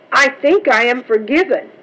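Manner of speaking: ordinary delivery, nothing marked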